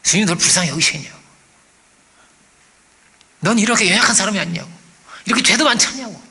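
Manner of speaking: lightly voiced devil, taunting with rhetorical questions; accusing devil. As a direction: press doubts on the listener like an accuser. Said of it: "별 얘기를 마귀가 할 것입니다".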